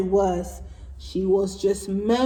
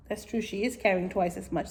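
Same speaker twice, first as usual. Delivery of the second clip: usual speaking voice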